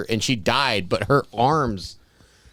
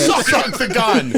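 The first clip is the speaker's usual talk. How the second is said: high, slow, laughing through the words; laughing joke; 10 semitones higher, slower than usual. Pretend. Friend jokes about the sheriff's gun, laughing. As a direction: giggle it out, higher and slower.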